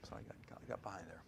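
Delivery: a quick whispered aside, off mic. Real, not acted. Preacher admits losing his place, whispering. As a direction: mutter it to yourself under the breath.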